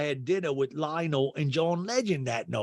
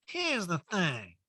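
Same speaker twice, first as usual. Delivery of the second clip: high, slow catchphrase impression; Erica; 6 semitones higher, 4 dB quieter, slower than usual. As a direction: stretch out a mimicked catchphrase, pitched up. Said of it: "She always does".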